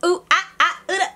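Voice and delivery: high, laughing, squealing excitement; giddy hype. Retold or imitated. Friends back at the college, giddy and squealing.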